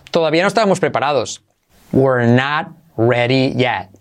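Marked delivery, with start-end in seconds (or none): 1.9-3.9 slow, spaced-out demonstration; deliberate teaching pace; slow model phrase. Shown as a demonstration. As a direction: say each word separately and slowly. Teacher models new phrase slowly.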